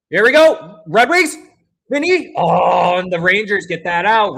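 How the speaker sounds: high, loud burst as the chance builds; excited shout. Real, not acted. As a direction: cheer the rush on at full voice. Scoring chance for the team.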